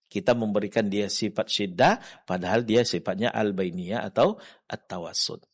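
ordinary talk

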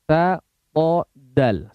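"Ta, po, dal" each articulated crisply; demonstrating letters. Shown as a demonstration. Teacher compares letter sounds for the class, deliberate. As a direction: run the three letter sounds one by one. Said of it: "untuk mengucapkan huruf T".

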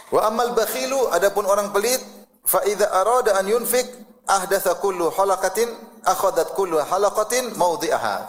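chanted Arabic quote; hadith recitation. Acted. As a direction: recite the hadith text with weight.

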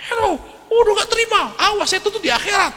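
high mock yell of an offended woman. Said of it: "Sampai teriak-teriak". (teriakan tiruan bernada tinggi, tidak keras)

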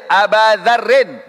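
"Aba Dzarrin" with long a ending Aba; alif reading. Shown as a demonstration. Teacher giving an example with alif, "Aba" with long a. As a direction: slowly model "Aba Dzarrin", drawing out the a. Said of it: "Pakai alif".

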